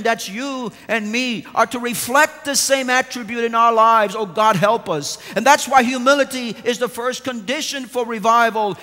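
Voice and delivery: stirring pulpit appeal; full-force preaching. Acted. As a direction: press the charge on the congregation.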